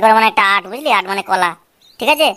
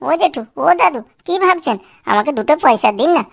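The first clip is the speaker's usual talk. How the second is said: whining beggar; 2 semitones higher than usual. Acted. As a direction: wheedle coins from passers-by. Box keeps playing begging.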